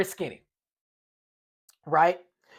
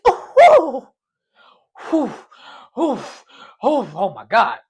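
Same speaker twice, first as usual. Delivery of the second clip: cold shock gasps; 5 dB louder than usual. Retold or imitated. Leg into ice water.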